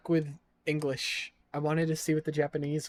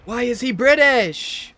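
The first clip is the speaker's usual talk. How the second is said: loud, high, stretched-out yell; exasperated outcry; 8 semitones higher, 12 dB louder, slower than usual. Real, not acted. Player objects to main character's voice, exasperated yell. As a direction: cry it out in slow disbelief.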